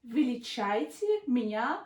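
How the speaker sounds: "Величайте меня" drawn out slowly; slow demonstration. Shown as a demonstration. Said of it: "So you go and say".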